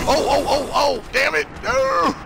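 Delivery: high frantic cries; panicked yelling. Real, not acted. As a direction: yelp it in panic.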